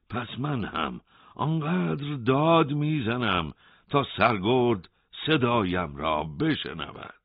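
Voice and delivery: defiant. A narrator's reading. Hossein threatens to keep shouting.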